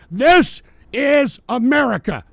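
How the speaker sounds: slow, high and loud, every word hammered; loud drawn-out declaration. Real, not acted. Streamer declaring to viewers, slow and loud. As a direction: plant each word like a verdict.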